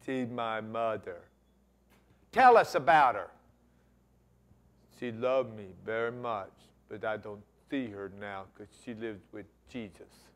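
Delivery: gentle halting voice. Retold or imitated. Kerry voiced in story.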